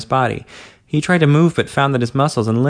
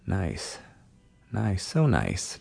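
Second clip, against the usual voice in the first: very soft, slow murmured praise; distant murmur; 4 semitones lower, 10 dB quieter, much slower than usual. A narrator's reading. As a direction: breathe it quietly from far away. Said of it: "Don heard as though from a distance".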